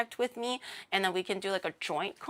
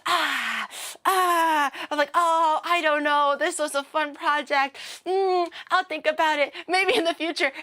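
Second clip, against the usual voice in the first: higher, hesitant, evasive polite reply; higher, polite, noncommittal hedging; roundabout Japanese answer; 6 semitones higher than usual. Retold or imitated. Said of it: "lock back into the Japanese brain".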